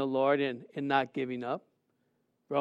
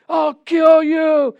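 slow, high, loud threat; in-your-face shout; 9 semitones higher, 8 dB louder, much slower than usual. Retold or imitated. Big guy in the brother's face, shouting a threat. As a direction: bellow it, dragging out KILL. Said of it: "was in his face saying".

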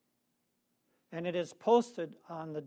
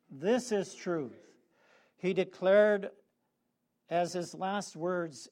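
ordinary talk

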